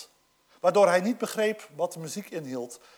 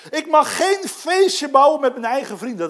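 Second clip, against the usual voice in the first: verwijtend klagen; 5 semitones higher, 7 dB louder than usual. Acted. Quoting angry eldest son.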